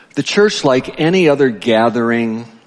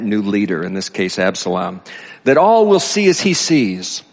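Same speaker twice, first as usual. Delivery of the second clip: higher, projected reading of a sage's speech; reading aloud; 4 semitones higher than usual. A narrator's reading. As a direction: read it out emphatically to a congregation.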